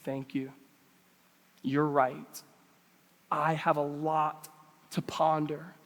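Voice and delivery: low, soft acted reply; humble acceptance. Preacher models a teachable reply to correction, humble and soft. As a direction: take correction quietly and slowly. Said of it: "Do you respond with a".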